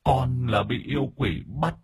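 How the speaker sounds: high, halting, plaintive ghost; wronged spirit's weak, broken plea; pleading spirit. Acted. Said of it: "Chú Kích bây giờ mới bình tĩnh hơn".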